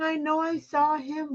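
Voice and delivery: ordinary talk, nothing marked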